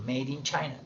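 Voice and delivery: Made dicho "med" y China "chaina", a lo británico. Shown as a demonstration. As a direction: di Made con una E corta británica. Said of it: "O británico". (Made in China británico)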